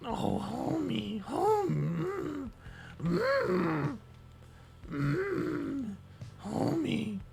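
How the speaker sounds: very high, slow straining Marge voice; failed Marge attempt; Marge impression. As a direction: strain way up for Marge, breaking into hums. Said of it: "My voice is too, like".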